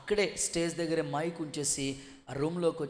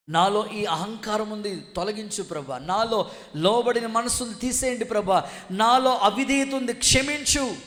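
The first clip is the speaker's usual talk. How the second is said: raised, louder plea to God; fervent preaching prayer; 6 semitones higher, 7 dB louder than usual. Acted. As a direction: cry out a confession before the congregation. Preaches a repentance prayer at force. (ఆవేశంతో కూడిన ప్రార్థన స్వరం)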